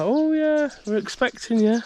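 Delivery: high, bright mock greeting; imitating a cheery site receptionist. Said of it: "She said".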